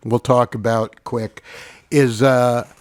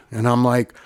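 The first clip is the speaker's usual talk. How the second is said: ordinary talk, nothing marked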